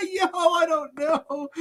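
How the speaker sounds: high, slow words through laughter; can barely speak for laughing; helpless laughter. Real, not acted. Cracking up at rhino question.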